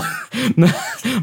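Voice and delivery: put-on high rising old-man titter; high giggle. Prime Minister giggling at his own fumble. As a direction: let out a high startled titter.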